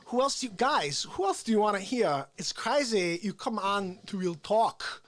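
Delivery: halting delivery with odd pauses and sudden pitch jumps; Christopher Walken. Acted. Comic as Walken pitching the show and warning of Corona, halting impression. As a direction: punch random words, stretch others. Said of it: "That's obviously my Christopher Walken".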